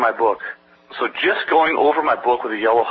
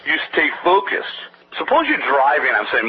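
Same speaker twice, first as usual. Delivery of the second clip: ordinary talk, nothing marked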